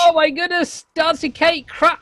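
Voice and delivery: high, loud shocked outburst; shocked exclaim. Real, not acted. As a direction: blurt it out in total surprise. Caster shocked by a crash.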